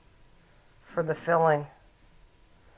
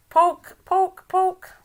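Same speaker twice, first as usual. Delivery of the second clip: poke; 11 semitones higher, much slower than usual. Pretend. Teasing friend who went quiet.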